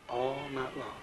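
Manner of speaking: hushed pulpit echo; soft echo. Real, not acted. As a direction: murmur the words back, trailing off. Preacher echoes his own phrase.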